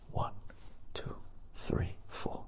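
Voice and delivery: very soft whispered counting. A narrator's reading. Narrator counts the four lights in a whisper. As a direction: breathe the numbers out slowly.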